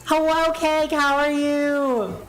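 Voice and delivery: high, stretched singsong hello; singsong welcome, held long; high drawn-out greeting. Real, not acted. Breaks off to greet a viewer.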